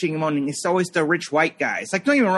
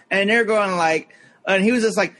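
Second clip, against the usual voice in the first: ordinary delivery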